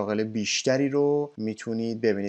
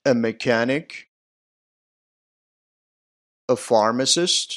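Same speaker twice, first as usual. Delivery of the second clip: loud, clear model words with pauses between; teacher leading a loud repeat-after-me drill; 5 dB louder, slower than usual. Shown as a demonstration. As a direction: call out each word for the class to repeat.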